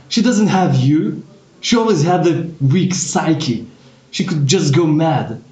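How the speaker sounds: father rages about his abandoned wife; furious rant. Acted. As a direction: rant at your son, voice climbing. Father's rage builds.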